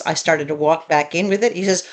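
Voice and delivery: ordinary talk, nothing marked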